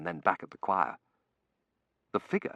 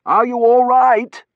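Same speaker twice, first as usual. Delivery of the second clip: high, loud, drawn-out question; shout; 16 dB louder, slower than usual. A narrator's reading. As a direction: shout your concern over the air raid. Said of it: "I shouted, looking at her".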